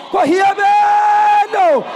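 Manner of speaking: long, high, loud ringing cry; drawn-out praise shout. Real, not acted. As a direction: stretch the word into a shout.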